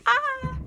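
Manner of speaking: sudden high squeal. Real, not acted.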